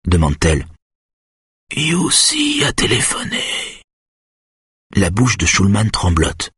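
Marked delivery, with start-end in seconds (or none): 1.8-3.8 slow, high, whispery thread of voice; shrill, strangled. A narrator's reading. Schulman reveals who phoned, slow shrill whisper. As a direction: strain it out high and breathless. Terrified patient answers in shrill voice.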